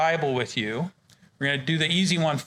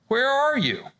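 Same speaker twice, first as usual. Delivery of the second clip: calling out; 9 semitones higher than usual. Acted. God calls to hiding Adam.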